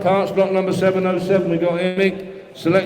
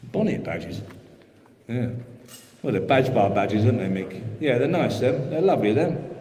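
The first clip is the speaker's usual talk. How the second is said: ordinary talk, nothing marked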